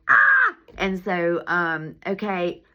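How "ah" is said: high sharp mock shriek; startled cry; retold scream. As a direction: yelp in alarm at the flood. Water pouring onto her things.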